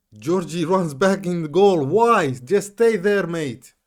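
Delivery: high, loud frantic pleading; agitated shouting. Real, not acted. Gamer pleading with his goalkeeper at a late corner, frantic shout. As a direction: beg the keeper to stay up, shouting.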